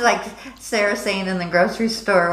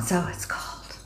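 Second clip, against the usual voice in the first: whisper; 9 dB quieter, slower than usual. Real, not acted. Repeating the weather remark.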